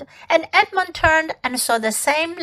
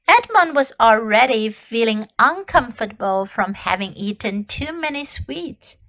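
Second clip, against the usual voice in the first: ordinary delivery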